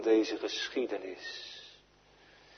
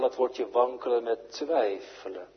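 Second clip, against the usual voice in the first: ordinary delivery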